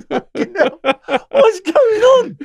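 squeaky high laugh-cry through the words; laughing disbelief. Real, not acted. Laughing to the host about the ballerinas, high and breaking up. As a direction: crack up while asking it. Laughing at his own prison story.

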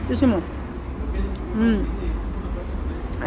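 ordinary talk, nothing marked

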